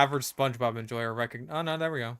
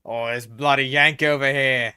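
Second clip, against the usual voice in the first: loud, high hammed-up Aussie accent; mock Aussie accent; 3 semitones higher, 7 dB louder than usual. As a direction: shout it like a rowdy Aussie bloke. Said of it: "Tipping a bit into Aussie".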